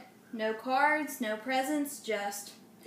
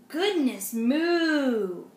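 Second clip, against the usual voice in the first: very slow, louder drawn-out moo voice; mooing cow; 6 dB louder, much slower than usual. Acted. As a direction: stretch it out like a lowing cow. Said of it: "she said".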